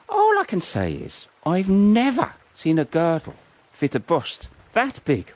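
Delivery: eager gossip; gleeful. Acted. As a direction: build up to the bawdy punchline. Bawdy boast about Margaret.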